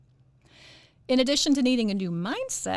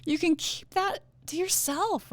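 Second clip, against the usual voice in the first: ordinary delivery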